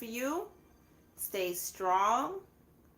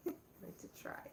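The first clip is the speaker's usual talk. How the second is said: breathless giggly whisper, a bit quicker; laughing whisper; 13 dB quieter, faster than usual. Real, not acted. Promising viewers giveaways, laughing it off in a whisper. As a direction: laugh it out under your breath.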